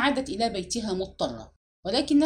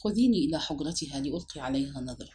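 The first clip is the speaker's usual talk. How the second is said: low, subdued, sorrowful request; understated sadness in a lowered voice; sad; 6 semitones lower than usual. A narrator's reading. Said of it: "ثم قلت لها بحزن".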